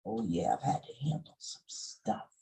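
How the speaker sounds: unvoiced, breathy whisper. Shown as a demonstration. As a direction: whisper it like a secret. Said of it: "whispered repeat".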